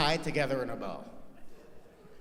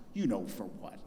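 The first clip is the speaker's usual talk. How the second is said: mom tone; 8 semitones lower, 6 dB quieter than usual. Retold or imitated. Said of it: "she has that mom tone".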